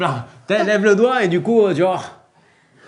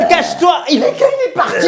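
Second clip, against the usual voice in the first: high panicked squeal; 6 semitones higher than usual. Pretend. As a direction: squeal in mock terror. Ogre arrives, panic.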